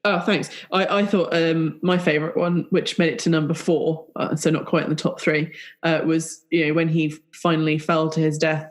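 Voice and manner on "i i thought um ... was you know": ordinary talk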